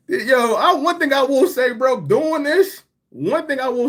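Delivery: high, loud call to break in; loud burst. Real, not acted. Cutting in on the conversation, loud and high. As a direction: jump in loud to take the floor.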